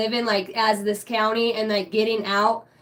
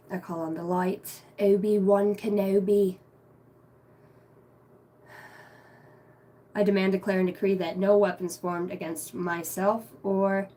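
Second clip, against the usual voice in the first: soft, solemn channeling prayer; invocation prayer; 3 semitones lower, 4 dB quieter than usual. Acted. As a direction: call on the angels reverently.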